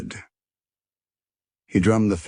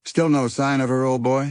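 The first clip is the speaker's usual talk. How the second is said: put-on higher, louder, chirpy old chap; bird character voice; 8 semitones higher, 5 dB louder than usual. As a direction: play Percy the bird, brighter and up. Percy the bird speaks.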